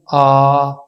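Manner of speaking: आ said long and loud; vowel sound demo. Shown as a demonstration. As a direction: model the vowel आ clearly. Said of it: "क्या sound था?". (आ की साफ़ आवाज़)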